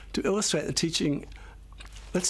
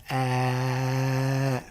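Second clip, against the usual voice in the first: saying ahh. Shown as a demonstration. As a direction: open free held ah. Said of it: "It just goes".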